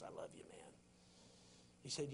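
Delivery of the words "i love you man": soft unvoiced whisper of God. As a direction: breathe it in a tender whisper. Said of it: "and he whispers".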